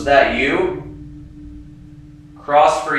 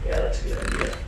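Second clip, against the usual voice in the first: nervous night vlog whisper; 6 dB quieter, faster than usual. Real, not acted. Quiet ghost hunt at night.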